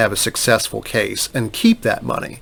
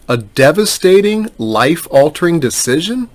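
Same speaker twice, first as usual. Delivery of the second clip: higher, questioning repeat with a rising end; incredulous echo; 5 semitones higher than usual. Retold or imitated. Lawyer challenging an adviser's dire claim, incredulous. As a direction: throw the phrase back as a doubting question.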